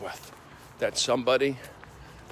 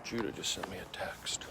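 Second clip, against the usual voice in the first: whisper; 6 semitones lower, 13 dB quieter than usual. Real, not acted. Reads phone aside to camera.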